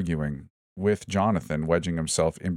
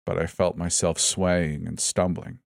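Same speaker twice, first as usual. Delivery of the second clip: usual speaking voice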